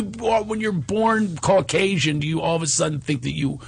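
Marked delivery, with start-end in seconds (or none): 0.0-2.2 stretched very slow; real incredulous slow emphasis; slow indignation. Real, not acted. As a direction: drag each word out in disbelief. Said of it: "indignant emphasis".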